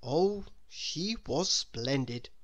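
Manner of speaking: plain reading voice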